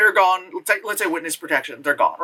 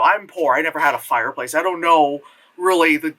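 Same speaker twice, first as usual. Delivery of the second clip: usual speaking voice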